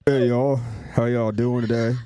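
lightly put-on folksy greeter; slight character voice, barely disguised; another character. Shown as a demonstration. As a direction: try a second voice that still sounds like you. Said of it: "now do another character".

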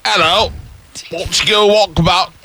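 cartoon Australian with high lilt; Australian accent. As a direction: greet them in broad Aussie. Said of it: "That's what they really sound like".

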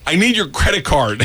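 kid's phone demand, retold; imitated daughter. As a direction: lightly play a child making a demand. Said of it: "looks at me and goes".

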